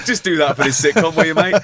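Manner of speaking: mock cheeky request. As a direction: send up your own nerve, loudly. Mocking his own cheeky request.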